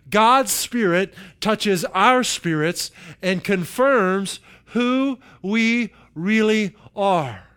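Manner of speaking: slow, high, stretched-out proclamation; emphatic scripture reading. Acted. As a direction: proclaim each phrase, leaning on every word. Reads the verse, stretched out.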